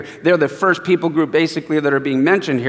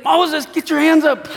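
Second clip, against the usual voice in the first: much higher, urgent call; urgent helpers; 10 semitones higher than usual. Retold or imitated. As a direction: shout up at him to raise his arms. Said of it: "they go".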